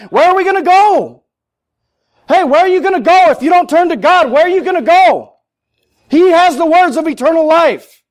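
high, insistent challenge to the congregation; full-force preaching. Real, not acted. Preacher challenges listeners on where they will turn, fervently.

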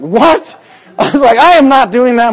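high, loud, laughing disbelief; laughing refusal. Retold or imitated. Shocked refusal.

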